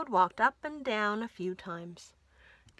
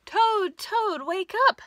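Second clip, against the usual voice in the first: much higher and louder, calling to wake him; 10 semitones higher, 11 dB louder than usual. Acted. Frog waking his sleeping friend, loud excited call.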